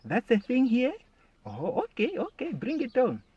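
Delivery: high-pitched challenged reaction; playful incredulity turning game; mock taken aback. Acted reaction to rival's accent.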